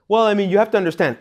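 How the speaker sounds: high-pitched mock excuse-making; self-justifying. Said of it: "You start self-justifying".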